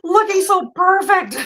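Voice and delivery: high-pitched, climbing exclamation of amazement; mock bafflement. Bag maker marvelling at others' wrinkle-free bags, mock bafflement. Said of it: "And I'm like".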